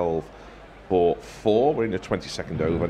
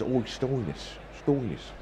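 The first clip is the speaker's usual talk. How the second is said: lightly voiced Cockney gang boss; a hint of a London hard man; Cockney gangster; 3 semitones higher, 5 dB quieter than usual. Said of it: "sounds like a kind of Cockney gang".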